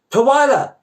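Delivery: high, loud call across a store. Acted. Woman in the checkout line calls out to Twyla, high and loud. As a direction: call her name from down the line.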